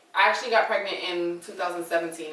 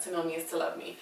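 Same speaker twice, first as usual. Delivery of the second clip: "To know me is to love me" said much softer, almost to herself; soft repeat; 2 semitones lower, 11 dB quieter, faster than usual. Shown as a demonstration. Repeating her catchphrase to viewers, much softer. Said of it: "repeats catchphrase to viewers".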